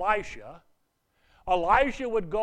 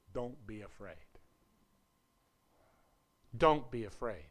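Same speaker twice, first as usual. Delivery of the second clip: softer, gentle and steady; calm reassurance; 7 semitones lower, 7 dB quieter than usual. Retold or imitated. Elisha calming his frightened servant, soft reassurance. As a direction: settle a scared servant without any alarm. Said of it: "Elisha calmly says".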